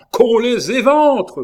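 high, drawn-out bloodthirsty yell; vociferating. A narrator's reading. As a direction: bellow it slowly, voice pushed high. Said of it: "vociféra Maugiron". (vocifération aiguë et traînante)

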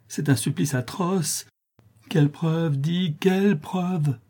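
high, drawn-out begging; tormented pleading. A narrator's reading. Notary begging Cécilie to name the proof, tormented. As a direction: beg slowly in a high anguished voice. Tormented suitor begs for an answer.

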